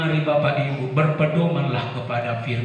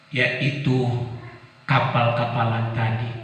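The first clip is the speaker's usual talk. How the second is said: usual speaking voice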